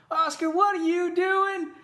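loud, high, drawn-out Texan holler. Retold or imitated. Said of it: "like, real Texan".

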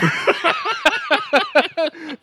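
high, loud cackling laugh; helpless high-pitched laughing; laughter. Real, not acted. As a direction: crack up at your own joke. Cracking up at own noozle joke.

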